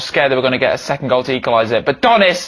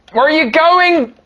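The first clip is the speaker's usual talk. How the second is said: pleading cry; 12 semitones higher, 3 dB louder, slower than usual. Acted. Pleading to departing figure.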